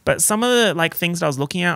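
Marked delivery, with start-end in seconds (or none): none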